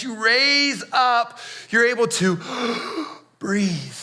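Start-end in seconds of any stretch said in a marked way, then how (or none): 2.4-3.1 gasp. Acted. Acting out gasping on cross.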